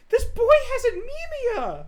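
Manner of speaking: high, loud, drawn-out shout; slow, high theatrical yell; mock outraged cry. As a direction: holler it with hammed-up outrage. Mock outcry during game reading.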